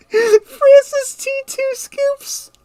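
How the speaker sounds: squeaky laughing voice; corny dad laugh. Real, not acted. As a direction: wheeze the name out while laughing. Laughing at own joke.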